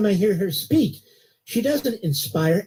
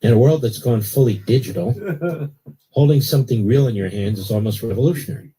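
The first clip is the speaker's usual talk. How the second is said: steady, lower-pitched sponsor read; 6 semitones lower than usual. Acted. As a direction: sell the magazine like a live radio spot. Host reads a magazine ad.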